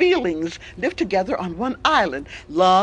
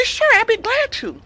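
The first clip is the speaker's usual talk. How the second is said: cheerful monkey; 13 semitones higher than usual. Acted. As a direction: sing out a hello as a chirpy monkey. Monkey agrees eagerly.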